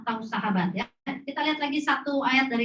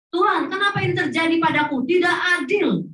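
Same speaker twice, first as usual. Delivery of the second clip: higher, louder, shouting Tidak adil; angry complaint to God; 7 semitones higher, 5 dB louder than usual. Retold or imitated. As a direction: rage at God like a wronged believer. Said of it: "yang saya marahin Tuhan". (protes marah kepada Tuhan, berteriak)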